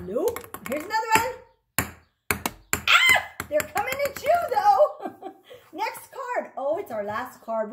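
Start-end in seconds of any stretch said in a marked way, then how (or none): 2.7-3.2 short high startled cry; startled shriek. Real, not acted. Yelp as the cards come flying.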